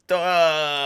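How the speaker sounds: loud, high drawn-out "duh"; hammed-up stunned reaction; mock dumbfounded noise. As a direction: let out a baffled, exaggerated groan. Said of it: "where you're like".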